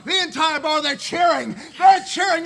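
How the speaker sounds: loud, high excited yell; excited shout. Acted. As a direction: shout the news triumphantly. Climax of the story.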